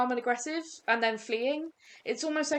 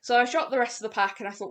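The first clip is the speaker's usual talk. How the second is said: normal talking voice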